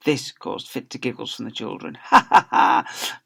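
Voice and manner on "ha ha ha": slow, breathy ha ha ha; crazed teacher's slow ha ha ha; mad laugh. Acted. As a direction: laugh like he's lost his mind.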